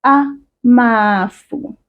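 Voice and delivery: Amafu drawn out slowly, every vowel full; slow model word. Shown as a demonstration. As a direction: lay it out slowly for learners. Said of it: "teacher models word".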